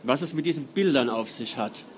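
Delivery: measured, dramatic reading voice; story narration. A narrator's reading. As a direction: read it like an audiobook. Author reads novel prologue aloud.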